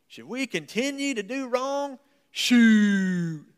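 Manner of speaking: high, loud Southern country drawl; country boy translation. Pretend. Preacher doing his country boy take on Paul, loud Southern twang. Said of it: "let me give you the country boy translation".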